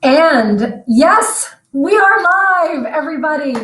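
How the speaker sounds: loud, drawn-out big announcement; excited announcement. Real, not acted. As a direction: kick off the show with a bang. Host opening a live broadcast.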